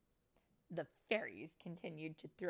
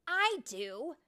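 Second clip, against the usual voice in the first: high, loud reply; emphatic insistence; 10 semitones higher, 11 dB louder than usual. Acted. Tink to her friends about the mainland, emphatic. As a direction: insist that it matters to you.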